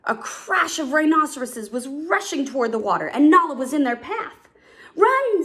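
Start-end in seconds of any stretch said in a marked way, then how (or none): 5.0-5.4 high, loud one-word warning; urgent shout. A narrator's reading. Cub warning friend in rhinos' path, shouting. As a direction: shout it to get her moving. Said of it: "Simba shouted".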